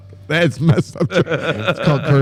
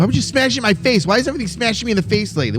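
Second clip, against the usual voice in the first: indignant complaint; 10 semitones higher than usual. Real, not acted. Mock complaint about face smashing.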